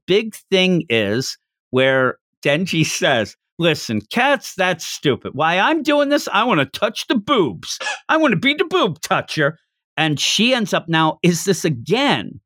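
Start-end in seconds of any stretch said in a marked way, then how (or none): 3.6-9.6 mock-fervent, climbing high in pitch; over-the-top declaration. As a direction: declare a silly goal with comic zeal. Acting out over-the-top Denji's declaration.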